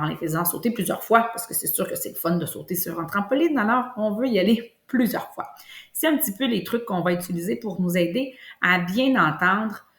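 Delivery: ordinary delivery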